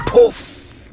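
high, loud magic pop; puff bang. A narrator's reading. Narrator voices the magic bang, high and loud.

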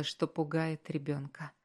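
ordinary delivery, nothing marked

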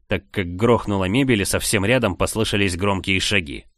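ordinary delivery

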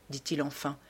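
ordinary delivery

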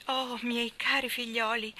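slow, a touch of anguish; grieving exclamation. A narrator's reading. Aunt Moss greeting the children, slow and grieving. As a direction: cry out to the children in distress. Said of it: "esclamò".

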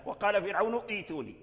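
فرعون إيتوني broken by a glottal ee before tuni; faulty sounded hamza. Retold or imitated. Teacher mimicking the error, hamza kept in إيتوني. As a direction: keep a wrong hamza at the start of إيتوني. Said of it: "هذا لحن في القرآن".

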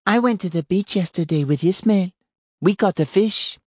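put-on high, eager child voice; excited little boy. A narrator's reading. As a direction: tell it like a proud small boy. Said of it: "The boy said".

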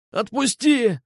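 high-pitched pained squeal. A narrator's reading. Boy with twisted arm begs release, squealing. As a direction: squeal to be let go. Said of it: "завизжал он".

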